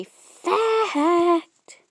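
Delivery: high drawn-out squeak. Acted. Playful sing-song ending.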